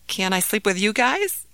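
ordinary delivery, nothing marked

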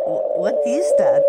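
loud, startled what is that. Real, not acted. Host startled by a caller's harmonica dog howl, loud and surprised. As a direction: caught off guard by a strange noise.

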